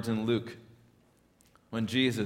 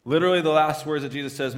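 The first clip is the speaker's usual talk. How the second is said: ordinary delivery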